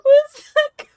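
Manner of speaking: very high, squeezed, breaking into laughs; crying with laughter. Real, not acted. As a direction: try to speak while laughing helplessly. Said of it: "Oh my God, I'm crying".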